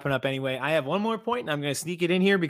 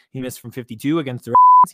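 emphatic outburst; 10 dB louder than usual. Real, not acted. Repeating the stat for emphasis.

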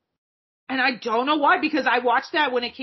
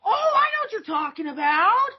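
excited recognition; 8 semitones higher than usual. Real, not acted. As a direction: jump in excitedly.

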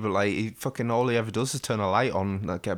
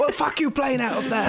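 cockney Alfred; 3 dB louder than usual. Retold or imitated.

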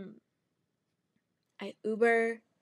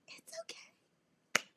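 tiny, very high, slow whisper; mock tearful squeak; 13 semitones higher, much slower than usual. Stranded driver lying to helper, mock tearful. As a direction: squeeze it out, feigning held-back sobs. Repeats it, nearly crying.

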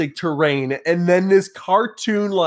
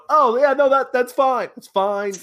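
higher-pitched mock reassurance; appeased neighbours; 9 semitones higher than usual. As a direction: wave it off as suddenly placated neighbours. Voicing angry neighbours suddenly appeased.

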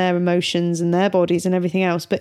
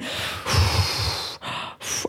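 slow unvoiced dragon breath out; long calming breath; 6 dB quieter than usual. Mother imitating daughter's calming dragon breath. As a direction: blow out the anger slowly. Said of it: "and went".

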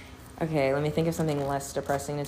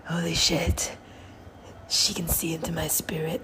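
hushed, half-whispered mock amazement. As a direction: imitate an awestruck man, breath taking over the voice. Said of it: "M G K was like".

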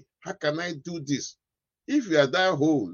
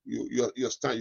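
normal talking voice

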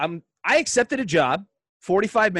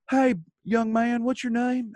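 put-on higher, kindly old-timer voice; old man voice; 9 semitones higher, slower than usual. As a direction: greet the kid like a friendly old veteran. Old man greets him backstage.

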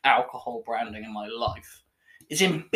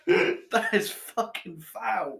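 laughing in disgust; 6 semitones higher, much slower than usual. Real, not acted. Reacting to foul beer.

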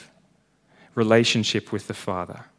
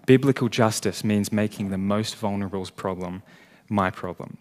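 ordinary talk

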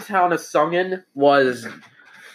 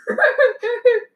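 squealing laugh, way up high; high burst of laughter; 4 dB louder than usual. Real, not acted. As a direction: break into a high giggle. GM laughs at own joke.

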